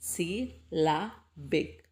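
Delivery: "സി, ല, ബിക്" as three separate beats; syllable by syllable. Shown as a demonstration. Teacher splits the word for pupils, "സി, ല, ബിക്" syllable by syllable. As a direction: say "സി", "ല", "ബിക്" singly, pausing after each. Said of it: "വവൽസിൻ്റെ sound വരുന്നുണ്ട്".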